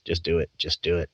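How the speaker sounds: low, soft voice of the entity; quiet urging. Retold or imitated. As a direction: urge gently, dropped down low. Entity urges him to quit job.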